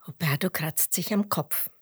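ordinary delivery, nothing marked

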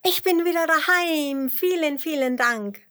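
high, loud jubilant worm; jubelnd. A narrator's reading. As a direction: shout happy thanks in a small high voice. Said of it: "jubelt der kleine Regenwurm". (lauter, hoher Freudenjubel)

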